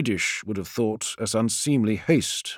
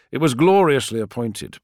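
usual speaking voice

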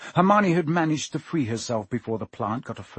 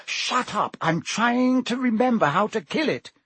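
snapping; 9 semitones higher than usual. A narrator's reading. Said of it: "said Hermione".

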